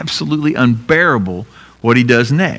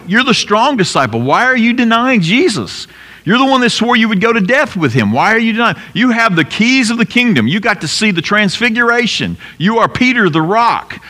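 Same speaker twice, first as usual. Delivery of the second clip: disciples questioning Peter; 10 semitones higher than usual. Retold or imitated. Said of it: "all the disciples will eventually be like".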